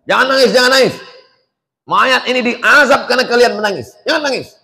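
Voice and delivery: high, loud imitation of a busybody scolding; mock stern hushing of the weeping family; scolding mourners.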